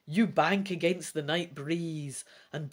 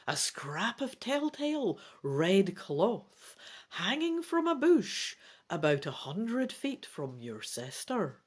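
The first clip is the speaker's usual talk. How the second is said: plain reading voice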